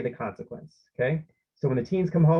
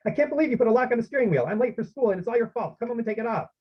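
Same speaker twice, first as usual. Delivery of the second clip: higher, hurried mock outrage; lightly acted angry teenager; indignant read-aloud complaint, pitched up; acted teen protest; 9 semitones higher, faster than usual. Teen's angry phone call, read aloud.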